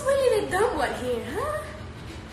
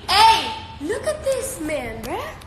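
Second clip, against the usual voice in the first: ordinary delivery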